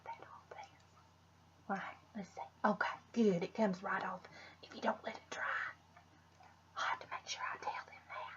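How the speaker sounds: soft whisper while wiping; whispered to herself. Real, not acted. Vlogger whispering to herself while wiping off the stain. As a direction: mutter it in a whisper as you clean up.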